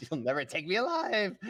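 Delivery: high, loud mock-heroic cry; hammed-up defiant getaway line, laughing; Jimmy's defiant cry. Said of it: "Jimmy says".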